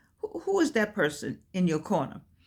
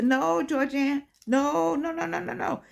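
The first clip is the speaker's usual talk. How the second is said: higher, gentle hushing no, repeated and softening; soothing no; 6 semitones higher than usual. Retold or imitated. Corner people soothing Georgianne who wants to quit, gentle and higher. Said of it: "people in the corner say".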